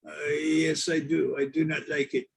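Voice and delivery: lightly put-on French accent. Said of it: "Are you from France?".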